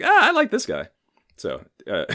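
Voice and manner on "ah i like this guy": delighted high voice. Retold or imitated. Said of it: "I was like".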